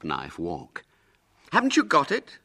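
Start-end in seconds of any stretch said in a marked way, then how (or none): 1.5-2.4 high, loud eager question; calling out. Acted. Ginger approaching William about the knife, calling out. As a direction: call over to a friend. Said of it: "called Ginger".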